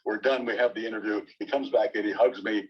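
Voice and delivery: usual speaking voice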